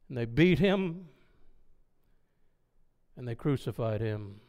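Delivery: low, hushed telling with long pauses; solemn. Retold or imitated. Philip tells Simeon how Jesus was excluded and crucified, solemn.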